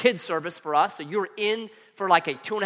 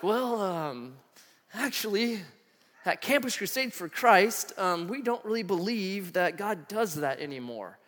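hesitant, drawn-out mock-nervous reply; 5 dB quieter, slower than usual. Campus mentor letting student down, mock nervous. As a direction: stall awkwardly, stretching the opening words. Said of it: "he just got nervous".

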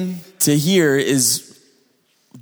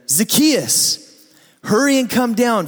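calling out; 6 semitones higher than usual. Acted. Jesus calling up into the tree.

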